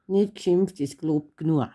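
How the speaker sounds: Bavarian "Nicht geschimpft ist gelobt genug" with "nua" at the end. Shown as a demonstration. Daughter shows Papa the Bavarian version. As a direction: say it the way they say it in Bavaria, dropping sounds. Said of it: "Und jetzt auf Bayerisch".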